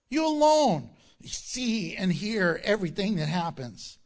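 loud, high pleading prayer as the king; Hezekiah praying. Acted. King Hezekiah begging God to hear, loud and pleading. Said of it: "He's saying".

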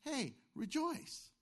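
slow, light, breezy "Hey, rejoice"; casual cheerful hey. Retold or imitated. Paul chained in prison, writing to the church, breezy and slow. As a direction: toss it off cheerily, as if nothing's wrong. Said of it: "and he's writing".

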